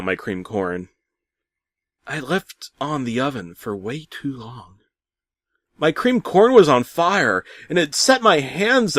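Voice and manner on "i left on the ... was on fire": ordinary delivery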